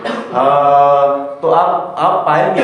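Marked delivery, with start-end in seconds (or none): 0.1-1.3 teacher sounding हा for students; breathy ha demo. Shown as a demonstration. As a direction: breathe out हा from the throat. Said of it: "आप हा का उच्चारण करके देखिए".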